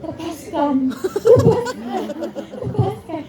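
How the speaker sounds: put-on high, laughing childish chant of the word; imitating small child. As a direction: copy a little kid repeating it back. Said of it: "tiba-tiba dia gini". (menirukan anak kecil sambil tertawa)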